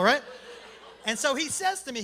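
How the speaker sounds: ordinary delivery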